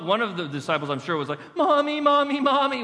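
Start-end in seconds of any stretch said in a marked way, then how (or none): 1.5-2.8 high, loud mock wail; hammed-up panicked child calling for mother; crying for mommy. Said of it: "was crying out".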